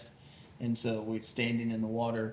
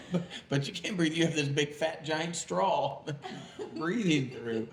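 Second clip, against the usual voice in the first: laughing through the words; 5 semitones higher than usual. Real, not acted. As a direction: giggle while you explain. Laughing while explaining snorkel.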